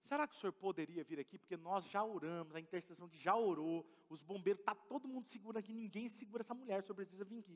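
hushed urgent phone request; caller on phone. Retold or imitated. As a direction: relay the urgent call softly. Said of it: "Me ligaram".

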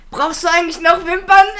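höher und lauter, als fragende Mutter; Mutter nachgemacht. Retold or imitated. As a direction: die Mutter quer durch den Laden fragen lassen. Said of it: "Und dann sagt sie". (Mutter fragt laut nach Wimpern, hoch und lauter)

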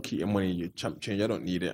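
soft, smug put-down; quiet scornful brag. Retold or imitated. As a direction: brag it quietly, looking down on him. Friend's boast retold softly.

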